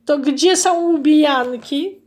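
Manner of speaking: slow, high resigned sigh of a question. Acted. Goose resignedly accepts the strawberry picking job. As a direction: give in with a weary question. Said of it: "z rezygnacją".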